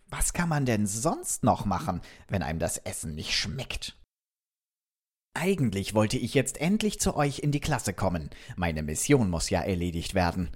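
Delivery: ordinary delivery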